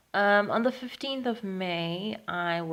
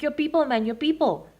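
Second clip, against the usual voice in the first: loud, raised, impatient repeat; retold insistence; 8 semitones higher, 7 dB louder than usual. Intruder to puzzled tenant, insistent and loud. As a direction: imitate an intruder pressing for an answer, loudly.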